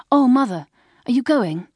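high, loud exclamation; breathless-with-excitement girlish question; excited daughter. A narrator's reading. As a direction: rush up to mother in surprise. Daughter surprised mother is leaving.

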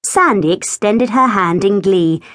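plain reading voice

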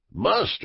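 "Must" lifted high and held alone; stress on Must. Acted. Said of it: "Did you mark particularly the word must?".

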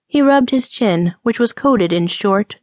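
ordinary delivery

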